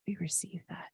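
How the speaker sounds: ordinary talk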